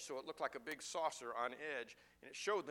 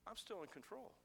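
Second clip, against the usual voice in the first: quiet voice of God; 10 dB quieter than usual. Acted. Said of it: "him saying to these people is this".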